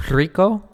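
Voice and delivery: Rico, high and loud, with a trilled first R; rolled R. Shown as a demonstration. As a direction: roll the R that starts Rico. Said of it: "Rico are definitely rolled".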